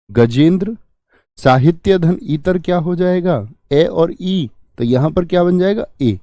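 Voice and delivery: ordinary delivery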